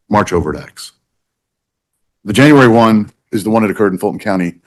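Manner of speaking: usual speaking voice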